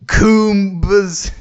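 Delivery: ordinary talk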